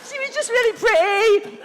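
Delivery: high, hammed-up whine; mock wailing. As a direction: wail the excuse in a squeaky mock cry. Wailed excuse for the plea.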